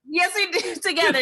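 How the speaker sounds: laughing through the words, loud and high. Real, not acted. Cohost agreeing with her partner, laughing loudly. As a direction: break into a real laugh mid-line. Cohosts joking about their show.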